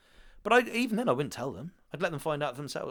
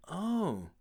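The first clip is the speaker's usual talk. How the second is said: higher, drawn-out "oh"; mock childlike letdown; retold dismayed realisation; 8 semitones higher than usual. Child's reaction to television revelation, retold.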